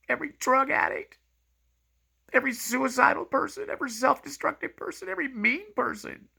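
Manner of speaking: choked-up, high cracking voice; voice breaking. Real, not acted. Speaker tells viewers Jesus cares for everyone, voice breaking.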